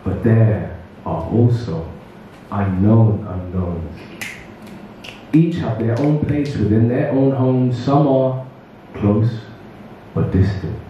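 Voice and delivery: deliberate spoken word with long pauses; poetry recital. Acted. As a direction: set each phrase apart for the audience. Recites own poem to audience.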